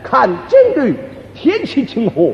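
mock Peking opera recitation; affected opera intonation. Shown as a demonstration. Walking down the street talking like opera, affected recitation. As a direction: declaim it like a stage actor. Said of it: "拿腔作调".

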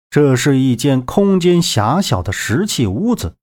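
plain reading voice

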